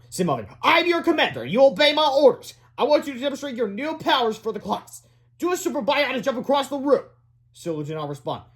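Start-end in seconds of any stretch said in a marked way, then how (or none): 0.6-7.1 high-pitched voice giving orders; commanding boy. A narrator's reading. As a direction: order the pet about like a commander. Boy gives hamster orders.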